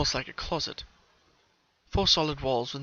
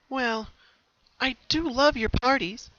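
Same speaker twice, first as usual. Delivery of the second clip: higher, slow, affectionate; smiling fondness; 11 semitones higher, 4 dB louder, slower than usual. Acted. Bellamina to her sister, fond. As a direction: say it fondly with a smile. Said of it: "Bellamina smiled".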